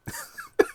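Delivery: short high wordless laugh; quick laugh. Real, not acted. Recapper amused by the scene, short laugh. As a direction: laugh under the breath before going on. Laughs before telling the joke.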